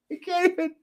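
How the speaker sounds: high laughing squeak through the words. Real, not acted. Host cracking up at his own referee joke, high laughing voice.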